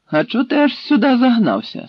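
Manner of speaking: high, loud challenging question; sharp interrogation. A narrator's reading. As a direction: question a captive, pitched well up and forceful. Captor interrogates the captured Tatar.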